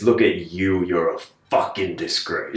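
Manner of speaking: "fucking disgrace" punched, scornful; stress on fucking. Shown as a demonstration. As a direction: spit out "fucking" at yourself. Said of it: "Whereas if it's".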